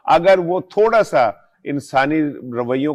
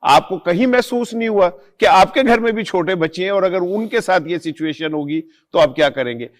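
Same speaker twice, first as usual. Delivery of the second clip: high-pitched, impassioned string of accusing questions; indignant reproach; 5 semitones higher than usual. Real, not acted. Commentator asks hotel owners about answering to Allah, indignant reproach. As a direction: challenge their conscience, pitch raised.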